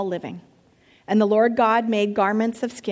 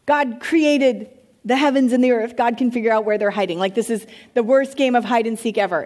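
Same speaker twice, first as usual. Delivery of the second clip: usual speaking voice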